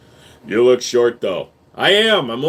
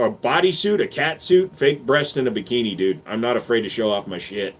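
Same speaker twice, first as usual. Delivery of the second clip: ordinary talk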